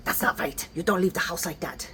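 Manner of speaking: a light German accent, imitating a scolding mother; a lightly done thick German accent, disapproving mother; mother's German accent. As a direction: mimic Mom's German accent as she scolds. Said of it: "thick German accent".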